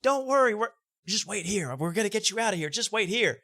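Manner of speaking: high, hammed-up reassuring woman; mock calm promise in a high voice; agent reassuring. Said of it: "and she goes".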